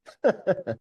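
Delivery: high, loud burst of laughter. Real, not acted. Guest laughing at his younger self, high bright laugh.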